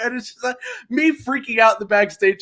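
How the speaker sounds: usual speaking voice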